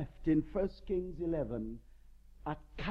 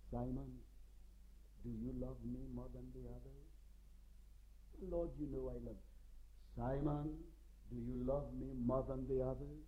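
gentle questioning; 4 semitones lower, 9 dB quieter than usual. Retold or imitated. Lord gently questioning Peter.

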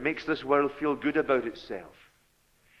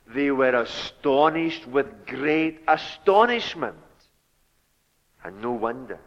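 usual speaking voice

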